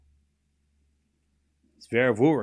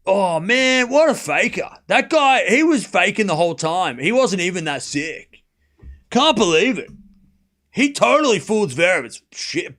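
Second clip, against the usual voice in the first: mocking complainer accent. Streamer mimicking viewers who cry fake injury, mock outrage in accent. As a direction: parody an outraged fan in a put-on accent. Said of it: "That's the accent".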